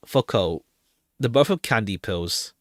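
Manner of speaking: usual speaking voice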